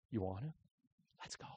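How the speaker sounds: low, very soft aside between comrades; under-the-breath dare; hushed conspiring. Retold or imitated. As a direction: quietly egg each other on, imitating the soldiers. Soldiers conspire before sneaking out.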